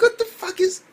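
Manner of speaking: loud, very high incredulous cry; exaggerated squeal of confusion; baffled reaction. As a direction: blurt out disbelief way up high. Retells own baffled reaction.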